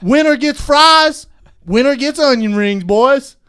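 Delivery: high, loud hammed-up Southern leer. Continuing redneck voice.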